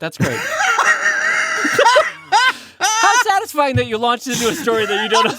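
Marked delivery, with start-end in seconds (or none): 0.4-2.7 loud high cackling laugh; cry-laughing shout. Real, not acted. Cracking up.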